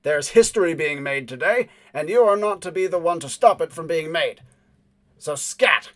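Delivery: a man with a bomb warning off the intruder; loud threat. Acted. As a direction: threaten him, loud and deliberate.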